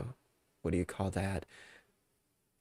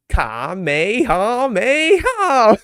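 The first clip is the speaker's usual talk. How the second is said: loud, high, drawn-out attack shout; mock battle cry; 10 semitones higher, 10 dB louder, much slower than usual. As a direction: belt out a slow anime attack call, rising in power. Performs Goku's attack call.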